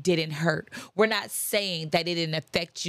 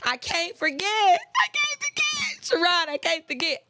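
hurt person pleading; 10 semitones higher than usual. Retold or imitated. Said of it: "people be like".

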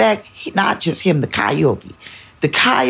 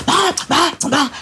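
put-on kids' whining protest; 13 semitones higher than usual.

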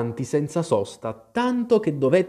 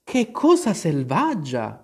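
slow shocked exclamation; exclaiming; 6 semitones higher, 3 dB louder than usual. Acted. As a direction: gasp at the savagery. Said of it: "esclamò Alice". (Alice esclama, stupita)

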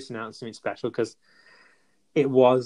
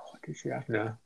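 whisper; 16 dB quieter than usual. Real, not acted. Unsure, asks partner off-mic.

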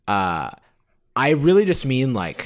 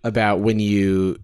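normal talking voice

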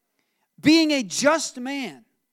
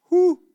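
loud, high exclamation of disbelief; loud high whoo; 8 semitones higher, 9 dB louder than usual. Real, not acted. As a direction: let out a big whoo. Imagining a pregnant donkey ride.

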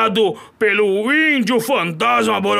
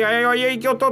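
normal talking voice

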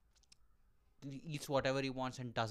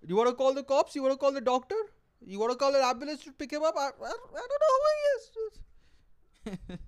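high, loud panicky denial; embarrassed friends; 10 semitones higher, 5 dB louder than usual. Pretend. Friends and girlfriend pretending not to know him, panicked. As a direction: disown him in a fluster. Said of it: "my friends and my girlfriend, look".

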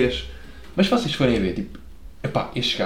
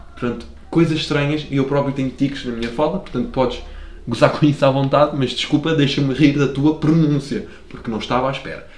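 normal talking voice

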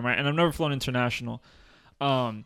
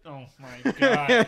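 slow, high "oh my God" through a laugh; laughing in shock at what he just heard; laughing disbelief; 8 semitones higher, much slower than usual. Real, not acted. Reacting to his friend blaming parents, laughing and shocked.

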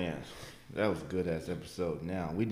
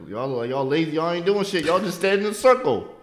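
loud insult rising in pitch; mock scolding, building higher and louder; heckling; 9 semitones higher, 8 dB louder than usual. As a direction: yell it out the car window. Heckling workers from a car.

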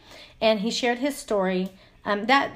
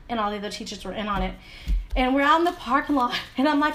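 ordinary talk

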